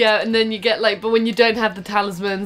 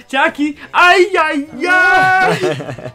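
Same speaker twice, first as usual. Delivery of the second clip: high, loud cartoon wail; cartoon catchphrase; 7 semitones higher, 5 dB louder, much slower than usual. Quoting the Jackie Chan cartoon, high cartoon catchphrase. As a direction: stretch out an exasperated cartoon cry.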